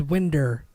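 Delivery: "winder" ending in -er, not -ow; wife's Kentucky pronunciation. Retold or imitated. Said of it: "and she says".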